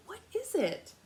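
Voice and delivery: put-on high child's question; little boy. Little boy asking Amu about the stew, high. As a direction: ask eagerly like a small boy. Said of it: "the little boy replied".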